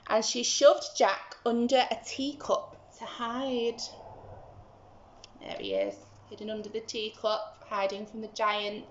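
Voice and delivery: ordinary delivery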